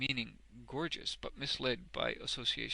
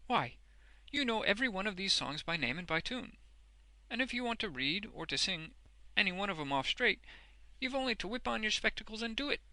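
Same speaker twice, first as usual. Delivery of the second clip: high, admiring character voice; enthuse, picking up speed at the end; excited cry; 8 semitones higher than usual. A narrator's reading. Boffin praising Wegg's skill with songs, excitedly. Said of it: "cried Mister Boffin".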